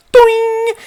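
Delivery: high, loud springy "doing" sound effect; cartoon boing. Possessed kid flinging the bully, loud high boing. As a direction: make the cartoon boing of someone flung.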